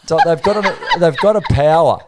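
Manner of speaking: loud laughing insistence. Real, not acted. Guest laughing about the corgis' power over him, loud and amused.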